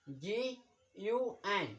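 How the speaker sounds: very slow letter by letter; slow spelling out. Shown as a demonstration. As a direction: spell each letter out, slowly. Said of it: "अब जी का pronounce".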